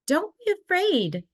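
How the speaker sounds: mother bear calling her cubs out into the snow. Acted. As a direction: call it out to cubs far off. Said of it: "called Mama Bear".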